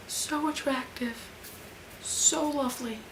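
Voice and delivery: soft, swooning admiration; hushed gushing. Real, not acted. Fan gushing over actor crush, hushed. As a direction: murmur it softly, besotted.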